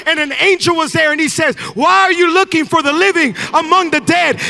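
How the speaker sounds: loud, high, shouted sermon climax; full-force preaching. Real, not acted. Pastor rallying the congregation about the resurrection, full-force preaching. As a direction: preach it with everything you have, building up.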